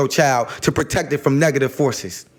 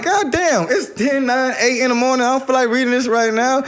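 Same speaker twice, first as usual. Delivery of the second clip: exasperated groan; 9 semitones higher than usual. Retold or imitated. Annoyed at flowery texts so early, exasperated. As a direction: throw up your hands at a long message. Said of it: "And I'm like".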